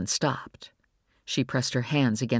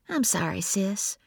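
quieter, gentle sorry; soft apology; 6 semitones higher than usual. A narrator's reading. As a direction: comfort her sister tenderly. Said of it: "her voice surprisingly soft".

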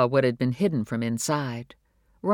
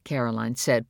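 ordinary delivery

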